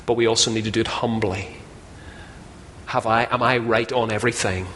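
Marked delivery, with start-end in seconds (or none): none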